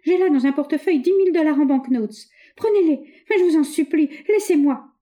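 high, loud frightened plea; terrified girl bribing her attacker; desperate pleading. A narrator's reading. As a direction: beg him to let her go. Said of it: "d'une voix étranglée".

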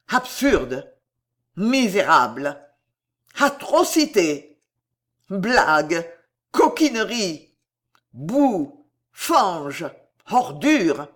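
disgusted one-word jeers; contemptuous outbursts, each word standing alone; scornful exclamations. A narrator's reading. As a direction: spit out each insult separately. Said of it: "manifestations plus ouvertes".

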